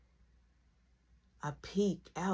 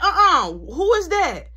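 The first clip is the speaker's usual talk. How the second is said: mom; 10 semitones higher, 10 dB louder, slower than usual. Retold or imitated. Mom voiced catching him.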